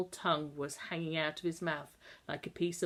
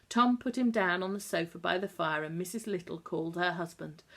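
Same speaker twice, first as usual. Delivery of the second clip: ordinary delivery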